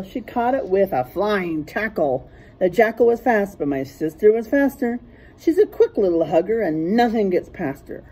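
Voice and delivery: usual speaking voice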